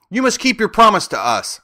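high, loud insistent demand; exclaiming. A narrator's reading. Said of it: "exclaimed Dorothy".

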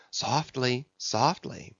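soft, careful easing; hushed coaxing. A narrator's reading. Officer eases his rowers between outbursts, softly coaxing. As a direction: drop soft to calm the stroke.

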